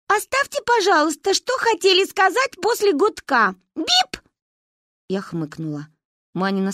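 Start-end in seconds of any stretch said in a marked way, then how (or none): 0.1-4.2 high, stiff machine voice ending in a squeaky beep; a girl pretending to be a robot; mechanical answering machine voice. A narrator's reading. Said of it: "механическим голосом автоответчика".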